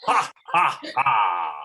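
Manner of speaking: loud throaty laugh from the chest; throaty villain laugh. Shown as a demonstration. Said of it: "Really feel it in your throat".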